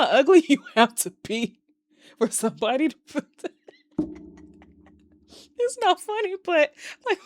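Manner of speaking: high-pitched, breaking up laughing; can barely get it out for laughing; real tickled laughter. Real, not acted. Viewer tickled by a ridiculous Reddit wish, real laughing disbelief. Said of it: "that tickled the hell out of me".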